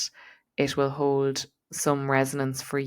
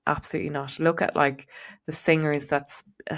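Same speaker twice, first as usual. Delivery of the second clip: usual speaking voice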